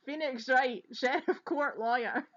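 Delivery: loud, hammed-up Scots announcement; mock-grand broad Scottish delivery; broad Scots accent. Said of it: "do this whole episode in a broad Scots accent".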